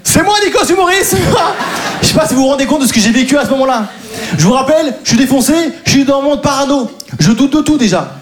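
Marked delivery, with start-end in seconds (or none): 0.0-2.0 mock triumphant shout. Comedian relives the absurd police moment, high laughing shout. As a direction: shout it out in disbelief. Acting the triumphant mistaken reply.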